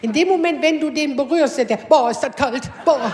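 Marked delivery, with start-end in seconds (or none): 1.8-3.1 exaggerated yelp at cold cream; hammed-up shock at the cold; husband's overdone yelp at cold ointment; mock cold yelp.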